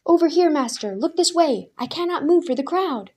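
high, loud call over a crowd. A narrator's reading. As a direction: shout to be seen above the throng. Crowd member calling out.

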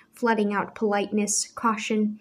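plain reading voice

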